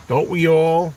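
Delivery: emphatic repeat. Real, not acted. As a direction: lift and punch "Don't we all?".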